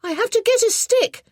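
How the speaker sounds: put-on high, loud determined little boy; a boy with a plan. A narrator's reading. Boy deciding to fetch stick.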